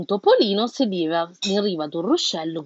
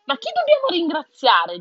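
animals asking; 8 semitones higher, 4 dB louder than usual. A narrator's reading. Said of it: "dicono gli animali".

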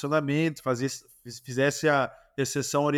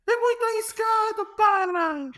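high-pitched mock coach shout with a Portuguese accent; Jorge Jesus impression; slower than usual. Coach yelling at his player in training, high mock Portuguese voice. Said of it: "ele grita assim".

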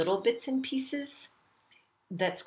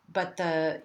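ordinary delivery

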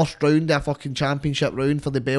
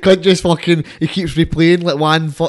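laughing; 4 semitones higher, 9 dB louder than usual. Real, not acted. Laughing at joke.

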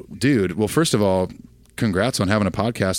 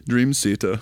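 put-on slow, thick accent on [name]; heavy accent; 3 semitones higher, slower than usual. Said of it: "saying [name] in Torben's accent".

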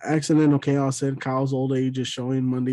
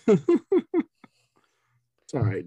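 laughing; 4 semitones higher, much slower than usual. Real, not acted. Laughing while reassuring.

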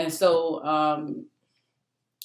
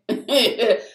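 a loud, higher burst of laughter; real laughter; 6 semitones higher, 6 dB louder than usual. Real, not acted. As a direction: laugh hard at it. Laughing at own story.

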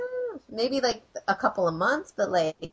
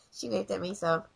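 ordinary talk

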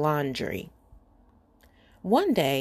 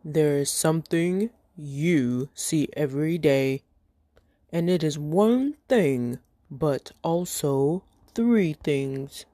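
giant voice; 3 semitones lower than usual. Acted. Giant gives the riddle.

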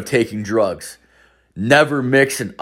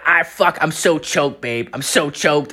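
lightly voiced girlfriend, higher pitch; girlfriend like boyfriend; 6 semitones higher than usual. Girlfriend complaining to him, lightly imitated in higher voice. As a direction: mimic her talking just like him. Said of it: "she talks identical to this guy".

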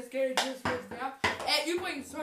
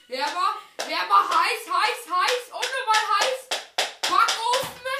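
getting hotter, excited and louder; building excitement, higher and louder; excited, rising; 5 semitones higher, 5 dB louder, slower than usual. Real, not acted. Hot-cold game, target close.

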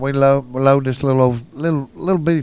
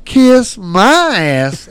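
slow, higher, drawn-out defiance; defiant refusal; 6 semitones higher, 7 dB louder, much slower than usual. Retold or imitated. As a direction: refuse loudly, stretching every word. Retold defiant refusal to friends.

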